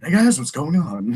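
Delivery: smoother, clearer jock voice; calm and collected, easy delivery; Trophy. Shown as a demonstration. Voice actor contrasting the smoother rival character, calm and collected. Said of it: "and then Trophy just".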